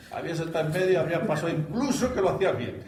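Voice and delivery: high, loud words through laughter; laughing while talking. Real, not acted. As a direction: keep joking through the giggles. Joking while still laughing. (hablando entre risas, agudo y fuerte)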